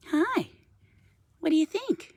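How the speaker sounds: pet talk. Real, not acted. High pet voice to raven.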